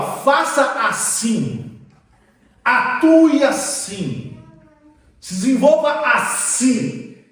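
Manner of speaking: alto e mais agudo, bem lento, palavra por palavra; ordens de Deus enfáticas. Retold or imitated. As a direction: proclamar cada ordem devagar com força. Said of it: "se ele tá falando, ó". (ordens de Deus proclamadas devagar e alto)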